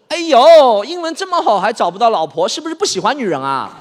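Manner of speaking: loud, high nosy auntie teasing; hammed-up gossiping aunt. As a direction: needle the nephew about marriage. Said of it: "然后我小姨看着我说".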